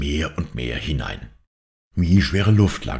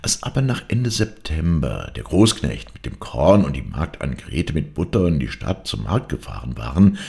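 plain reading voice

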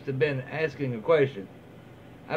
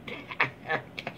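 soft breathy laugh; 10 dB quieter than usual. Real, not acted. Reader laughs at the comment.

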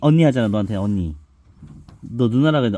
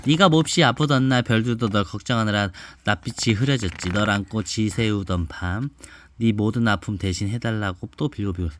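put-on reading to a child; 4 semitones lower than usual. Mother reads picture book aloud.

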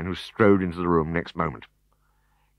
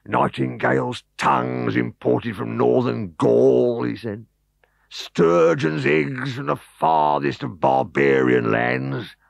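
grand boastful host; 6 semitones higher, 3 dB louder, slower than usual. Acted. Host lists delicacies grandly.

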